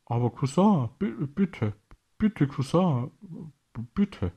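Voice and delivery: a helpless king stuttering please; faltering, desperate pleading; stammering plea. A narrator's reading. Helpless king begs his cousin.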